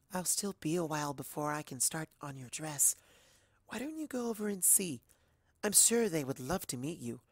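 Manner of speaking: soft, hushed shy voice; gentle Fluttershy. Acted. Fluttershy urging the narrator to go, soft and gentle. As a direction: suggest kindly.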